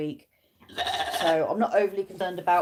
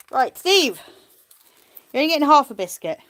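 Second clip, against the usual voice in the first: high, louder, fast; firm pet talk; 6 semitones higher, 5 dB louder than usual. Real, not acted. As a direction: bargain with him. Telling the sheep.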